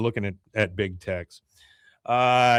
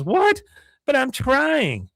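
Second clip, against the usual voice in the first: very high, drawn-out mock protest; imitated whiny niece; hurt niece; 13 semitones higher, 3 dB louder, slower than usual. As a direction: plead high like a wronged girl. Niece thrown off the team.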